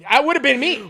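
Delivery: high and loud, half laughing; loud laughing punchline. Real, not acted. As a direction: hit the punchline big and high. Punchline about selling vacuums.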